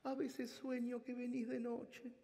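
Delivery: slow, high mock-tearful mother; torn voice. Mother telling her son about her dreams, slow mock-tearful voice. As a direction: let it ache, imitating. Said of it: "con la voz desgarrada".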